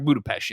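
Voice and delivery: "Budapest" ending "pesht", pitched a little down. Retold or imitated. She repeats her correction to him, "Budapest" with an SH. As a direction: insist on "Boo-da-pesht" for "Budapest". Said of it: "trying to correct that by saying Budapest".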